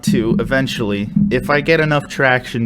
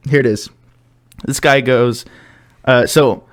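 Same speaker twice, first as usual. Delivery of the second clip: usual speaking voice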